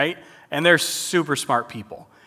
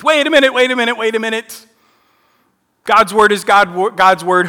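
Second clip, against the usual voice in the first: sudden high, loud "Wait a minute", then rattled off fast; mock alarm; 6 semitones higher, 6 dB louder than usual. Shown as a demonstration. Catching the changed verse in front of the youth group, mock alarmed. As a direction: play up the shock, quick and excited. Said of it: "mock alarm at the changed word".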